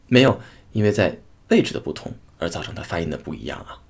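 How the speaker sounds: ordinary delivery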